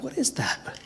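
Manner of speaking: high, soft whisper; hushed puzzlement. Retold or imitated. Retells puzzling at figure in van.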